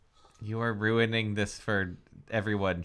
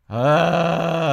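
normal talking voice

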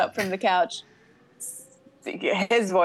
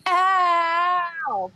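mild scream; 9 semitones higher than usual. Acted. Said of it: "Chris screamed".

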